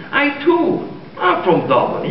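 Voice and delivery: Irish lilt, high at the start; jokey put-on Irish brogue; Irish accent. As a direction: claim it proudly as a stage Irishman. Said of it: "do I detect a bit of Irish?".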